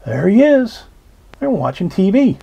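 usual speaking voice

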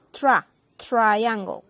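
Tra broken off, then triangle repeated slowly; slow syllable drill. Shown as a demonstration. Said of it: "en inglés se dice".

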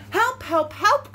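loud, rising storybook cry for help; cried out. A narrator's reading. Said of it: "cried the little blue truck".

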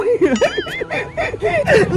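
high, soft villain giggle; long high-pitched mischievous laugh; scheming cackle. Acted. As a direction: cackle slyly over a secret plan. Schemer plans to steal wand.

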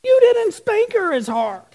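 put-on complaining kids. Siblings complain to dad that she got off easy, high and whiny. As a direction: whine it like a tattling sibling. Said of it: "the other two say".

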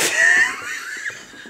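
helpless squealing laughter; hysterical laugh. Real, not acted. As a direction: burst into a high shrieking laugh. Shows husband her homemade sign.

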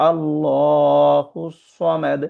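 very slow, drawn-out tajweed chant; Quran recitation. Acted. Teacher reciting the verse for the class, slow chant. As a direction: recite it slowly like a qari.

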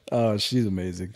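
ordinary talk

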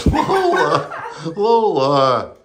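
loud name-call through laughter; laughing scold. Real, not acted. Dog is drinking the bath water, laughing scold. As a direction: call out in amused protest.

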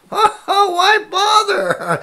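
high, drawn-out chuckling scoff; laughing dismissal. Acted. Bystander answers the worried child, laughing and dismissive. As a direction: scoff slowly through a laugh.

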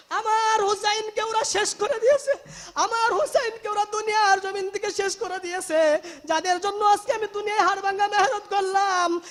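loud, high wailing lament in a preacher's retelling; tearful lament. Retold or imitated. Prophet telling Salama they killed his Husain, tearful lament. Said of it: "নবীজি কাঁদতেছে".

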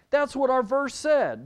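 ordinary talk

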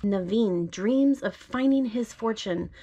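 gentle storybook read-aloud; reading to a child. Acted. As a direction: read it like a bedtime story to your little one. Parent reads picture book aloud.

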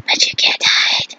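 menacing whisper, played up; whispered threat. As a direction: whisper a mock warning to a rival. Mock threat to Morris.

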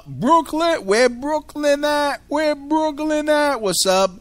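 high, loud crowd-rousing call; hype shout-out. Real, not acted. Host shouts out listeners in Brooklyn, loud hype call. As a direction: holler it like hyping up a crowd.

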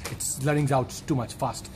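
ordinary talk, nothing marked

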